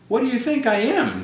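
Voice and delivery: high, offended tooth fairy; a huffy fairy insulted by the question; indignant tooth fairy. Acted. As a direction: tooth fairy snaps back at Andrew in a high voice. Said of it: "said the tooth fairy".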